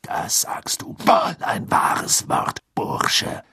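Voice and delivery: high, slow whispered aside; dry whisper to a neighbour; dry muttered whisper. A narrator's reading. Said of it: "in trockenem Ton ... zugemurmelt". (trocken geflüstert)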